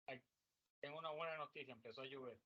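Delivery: distant, faint voice far from the microphone; far from the mic. Real, not acted. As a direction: speak from across the room, away from the mic. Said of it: "Yo te oigo muy lejos".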